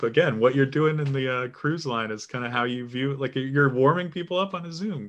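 delighted host raising his voice; excited delight. Real, not acted. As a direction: gush about the comedian trick.

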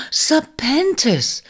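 sharp gasp, then high, drawn-out name; shocked gasp. Acted. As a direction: gasp and cry out in horror. Shocked at the injured snake.

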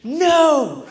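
put-on high wailing little girl calling for mommy; imitating a sobbing daughter; crying toddler. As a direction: wail it like a hurt child. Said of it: "And she's like".

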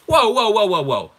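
loud burst, falling in pitch; emphatic halting shout. Real, not acted. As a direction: call a halt to the objectors. Halting the objectors he just voiced.

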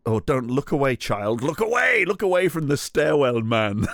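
high, hammed-up warning to a child; mock alarmed mother. Mother warns her child off the stairwell man, mock alarm. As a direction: shoo the kid away in mock horror.

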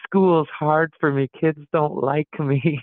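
half-laughing, hammed-up hardship voice; mock complaint through laughter. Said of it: "Giannis's kids are waking up this morning going".

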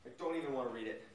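low mutter. Real, not acted. Reacting to a crazy chat with a low, quick mutter. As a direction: mumble it down under the breath.

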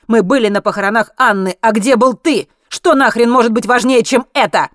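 loud, high, heated accusation; angry confrontation. A narrator's reading. Zlotan confronts Henry about missing Anna's funeral, angry shouting. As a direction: shout your reproach right at him.

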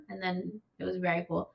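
ordinary delivery

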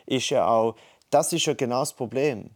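normal talking voice

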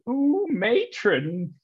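high, slow, drawn-out camp Ooh matron. Retold or imitated. Responding to a cheeky innuendo, drawn-out high camp Ooh. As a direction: stretch the Ooh high and slow like a Carry On gag. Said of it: "You just respond with".

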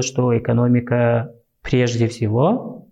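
normal talking voice

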